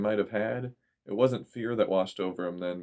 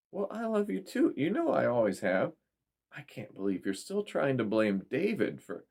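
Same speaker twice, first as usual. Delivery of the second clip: mother; 5 semitones higher than usual. A narrator's reading. Mother voiced on the phone.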